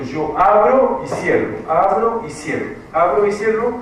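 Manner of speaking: abro y cierro hammered out each time, rising a little; repeated for emphasis. Shown as a demonstration. Teacher to writing class, repeating abro y cierro emphatically. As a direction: drill abro y cierro home word by word.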